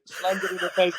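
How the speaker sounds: ordinary talk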